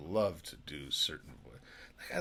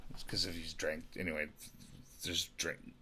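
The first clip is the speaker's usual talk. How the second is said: normal talking voice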